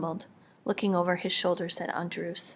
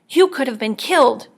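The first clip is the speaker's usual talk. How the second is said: frightened scolding; 4 semitones higher, 8 dB louder than usual. A narrator's reading. Frightened mother scolds children.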